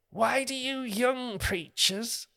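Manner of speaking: put-on high, drawn-out old man's voice. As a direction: imitate an old man's slow, high-pitched complaint. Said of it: "then this old man came up to me".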